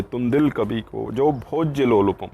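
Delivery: ordinary talk, nothing marked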